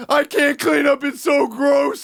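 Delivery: high, loud over-dramatic wail; hammed-up tearful disgust; mock sobbing. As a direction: wail it like a hysterical roommate, dragging the end. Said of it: "she's, like, sobbing".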